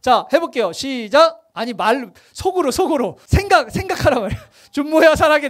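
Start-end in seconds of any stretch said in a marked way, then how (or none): 4.7-5.5 a louder blurt of the name; said too loudly. Real, not acted. Congregant blurting the prayer aloud, too loud. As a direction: say it out loud instead of silently. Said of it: "너무 크게 했어".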